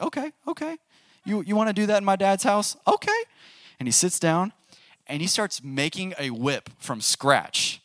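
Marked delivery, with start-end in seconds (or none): 0.0-3.2 Jesus unbothered. Retold or imitated. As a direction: play a cool, knowing Jesus, pitch way up. He's like.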